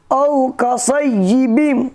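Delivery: औ कसय्यिबिम chanted slowly; tajweed reading. Shown as a demonstration. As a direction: read औ कसय्यिबिम carefully, chanting. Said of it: "गौर से सुनिएगा".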